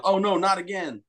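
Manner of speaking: high-pitched mock alarm, no real volume; retold mock yell. Guest joking about his hand falling off, mock yell. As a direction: play up a cry of dismay, pitch up high. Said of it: "And I yelled".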